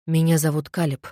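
plain reading voice

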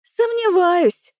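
high, slow, drawn-out doubt; sighed doubt. A narrator's reading. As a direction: stretch it out sceptically on an exhale. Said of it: "выдохнула Ада". (протяжно, с сомнением на выдохе)

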